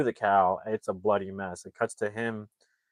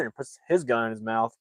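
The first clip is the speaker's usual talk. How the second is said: usual speaking voice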